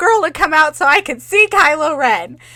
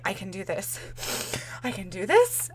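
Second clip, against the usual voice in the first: softer, lower self-talk; quiet reassuring mantra to self; calming self; 5 semitones lower, 11 dB quieter than usual. Retold or imitated. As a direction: talk yourself down under your breath. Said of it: "not having an anxiety attack".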